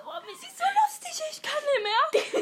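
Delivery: high-pitched fake laughing fit; squeaky sarcastic amusement; mock hilarity. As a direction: pretend to crack up at a lame joke. Mocks friend's joke as hilarious.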